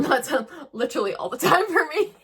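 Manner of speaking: half-laughing at her own confession. Real, not acted. Vlogger to viewers, owning up with a laugh. Laughs at admitting daily grief.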